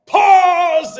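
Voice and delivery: very slow, high, booming wizard cry; Gandalf shout. Retold or imitated. Fan doing Gandalf for friends, booming slow shout. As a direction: bellow it like a wizard barring the bridge. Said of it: "it's terrible".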